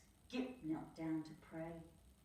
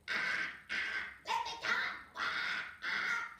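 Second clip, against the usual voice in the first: louder airy bird squawks; cockatoo squawk; 4 dB louder than usual. Acted. Cockatoo crying to be let go, louder squawking voice. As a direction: screech like a caught cockatoo. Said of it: "she cried".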